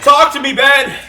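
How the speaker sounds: high, loud comic yell; shouting. Acted. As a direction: yell it at him. Host yells at guest.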